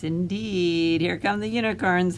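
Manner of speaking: ordinary delivery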